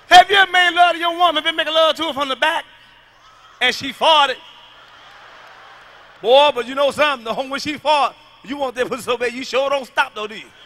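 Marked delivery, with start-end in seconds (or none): none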